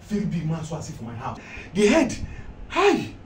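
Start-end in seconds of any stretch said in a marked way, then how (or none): 1.7-3.1 loud, high yelps of alarm; takut berlebihan. Acted. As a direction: exclaim in comic fright at the memory. Frightened account of a big mouse.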